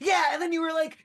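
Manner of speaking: high, loud, gushing retelling; excited fan. Acted. Fans retell the shootout to the hero, excited shouting. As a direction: burst in with a thrilled high yell.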